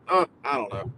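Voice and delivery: ordinary talk, nothing marked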